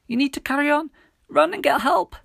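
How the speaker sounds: high, loud urgent plea; shouted. A narrator's reading. Injured Millie sending friend for help, high shout. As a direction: cry it out in a very high girl's voice. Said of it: "Millie shouted".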